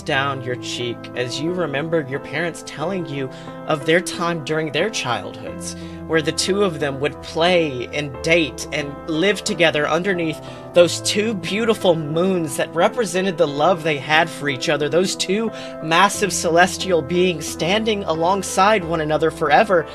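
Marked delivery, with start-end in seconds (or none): none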